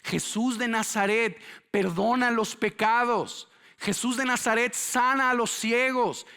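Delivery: high, loud proclamation; full-force preaching. Acted. Preacher relays what the blind man heard, full-force preaching. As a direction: proclaim it like exciting good news.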